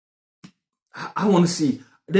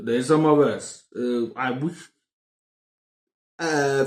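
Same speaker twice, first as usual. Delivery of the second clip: usual speaking voice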